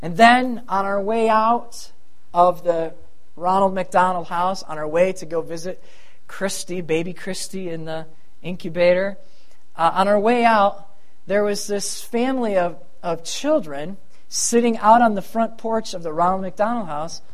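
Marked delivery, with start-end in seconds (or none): none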